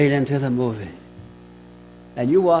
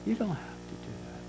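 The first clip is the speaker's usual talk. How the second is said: tempting inner voice; 3 semitones lower, 15 dB quieter than usual. Retold or imitated. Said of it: "we hear that voice that says".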